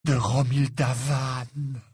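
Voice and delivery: very slow, dreamy declaration; reverent, lovestruck. A narrator's reading. Ron confesses his beloved's name to Harry, dreamy and reverent. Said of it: "répondit Ron à voix basse".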